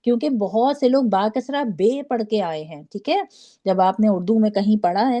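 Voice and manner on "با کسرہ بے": "بے" ends in ay in place of ee; wrong ay ending. Shown as a demonstration. Teacher quoting learners' mistake, kasra ending in ay. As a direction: misread the kasra as "بے", with an ay. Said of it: "اس سے پورا قرآن مجہول ہو جاتا ہے".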